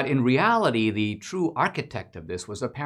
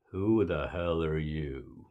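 low, soft, slow hostile voice; a suspicious Klansman at the door, menacing and quiet; man behind the door sizing up a stranger, low and slow; mock Klan leader; 6 semitones lower, 6 dB quieter, slower than usual. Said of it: "imaginative rendition".

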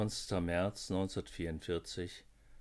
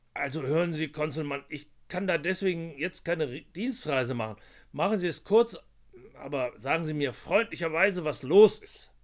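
impatience building to a louder push; irritated, rising to loud; 8 semitones higher, 11 dB louder than usual. Acted. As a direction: grit through annoyance, end loud. Irritated boss pushes caller.

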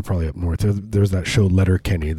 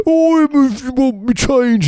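very high, slow Cockney whine; cartoon My Fair Lady Cockney, squealed; mock Cockney; 4 dB louder, slower than usual. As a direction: wail it as an untaught Cockney pupil. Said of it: "It's like My Fair Lady".

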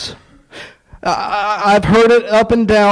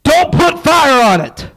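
commanding; 7 semitones higher, 3 dB louder than usual. Acted. God's command, quoted in a sermon.

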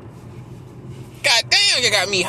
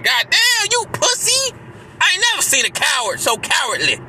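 furious outburst; 7 semitones higher than usual. Real, not acted. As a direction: explode at them in disgust. Angry outburst in rant.

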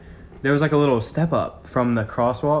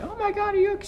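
put-on much higher, alarmed young woman; worried girl voice; much faster than usual. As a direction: squeak it out like a startled driver. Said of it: "she was like".

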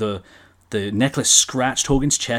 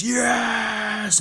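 much higher, full-throated yell; screamed yes; 12 semitones higher than usual. Retold or imitated. Hogan accepting Andre's challenge before the crowd, screaming. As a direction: throw your arms wide and scream it. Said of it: "puts his arms wide and screams".

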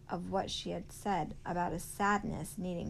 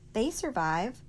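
higher, louder insistence; arguing; 4 semitones higher, 6 dB louder than usual. A narrator's reading. Sistine countering Willie Mae about the panthers, arguing. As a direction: push back with emphasis. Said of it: "argued Sistine".

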